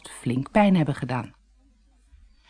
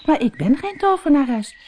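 put-on higher girl's voice, stumbling over the words; stammering girl; 7 semitones higher than usual. A narrator's reading. Doortje stammers to the witch she thinks she is not. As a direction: stammer it out, unsure and a little scared. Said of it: "stamelde ze".

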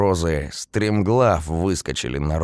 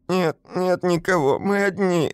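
crying; 14 semitones higher than usual. A narrator's reading. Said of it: "заплакала я".

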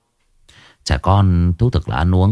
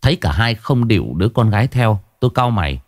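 ordinary delivery

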